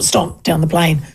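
normal talking voice

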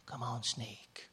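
slow, soft whisper; whispering slowly right up close to the snake. Retold or imitated. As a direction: coax the snake under your breath. Charmer leans in toward cobra.

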